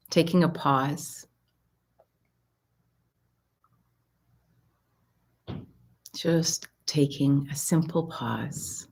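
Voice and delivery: calm meditation voice with long pauses; guided mindfulness. Real, not acted. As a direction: settle listeners gently, leaving space between phrases. Leads a mindfulness exercise.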